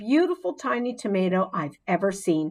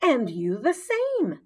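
1 squeaky reply; 6 semitones higher than usual. A narrator's reading. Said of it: "I squeaked back".

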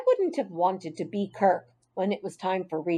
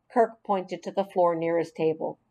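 ordinary delivery, nothing marked

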